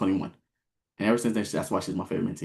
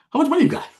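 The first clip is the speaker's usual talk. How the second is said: high, loud, incredulous question; retold astonishment; 7 dB louder than usual. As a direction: blurt it out in mock shock. Retelling shock at her deposit.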